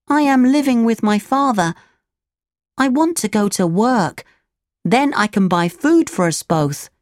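put-on high puppet boy voice; Pinocchio, earnest resolve.

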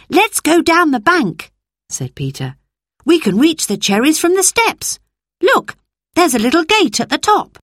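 put-on a keen boy pointing the way; eager boy Peter. Narrator voices boy Peter.

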